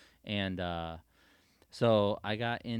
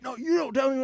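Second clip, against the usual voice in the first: high, loud mock angry sheriff; 11 dB louder, faster than usual. Sheriff yelling at the gate boss, high and angry. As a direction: snap back furiously. Sheriff going nuts at him.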